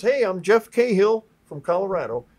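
imitating a hearty demonstrator; presenter's introduction. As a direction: project to the room, introducing yourself. Teacher introduces himself to class.

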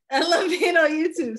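high, loud, laughing through the words. Real, not acted. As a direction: gush it out while laughing. Host echoes guest, laughing.

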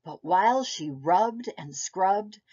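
ordinary delivery, nothing marked

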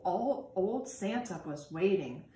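ordinary delivery

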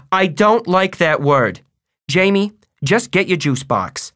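firm, high motherly telling-off; yelling. A narrator's reading. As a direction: scold firmly, a touch of a yell. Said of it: "Mom yelled".

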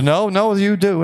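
high, laughing mock insistence; mock insistent class. Class insisting to the resident, imitated high with a laugh. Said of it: "Or says".

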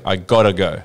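"I gotta go" with an Aussie "gotta", flapped to "godda"; Australian accent. Shown as a demonstration. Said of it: "you gotta say".

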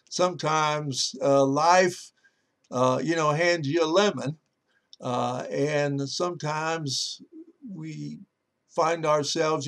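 usual speaking voice

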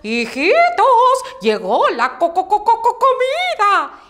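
hen clucking out comida, climbing up; mother hen calling her chicks to eat. Acted. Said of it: "gritó".

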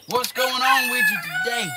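very high sing-song pet voice; a squeaky high greeting to the animals. Real, not acted. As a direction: coo up high at the babies.

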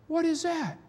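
high, astonished rebel soldiers; amazed rebels. Acted. Rebels crowding round the prisoner, high and amazed. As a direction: gape at the captured Yankee. Rebs gather around the prisoner.

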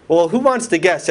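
ordinary delivery

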